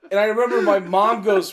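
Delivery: usual speaking voice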